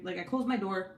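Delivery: ordinary delivery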